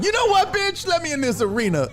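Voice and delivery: barge into the arena; determined cat. Acted. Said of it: "he made a business decision".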